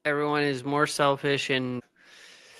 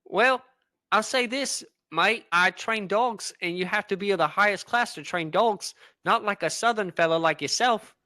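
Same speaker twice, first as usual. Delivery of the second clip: put-on wandering British-Australian accent. Posh British dog trainer mocks the southern man, in a wandering accent. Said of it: "you kinda got that wandering accent".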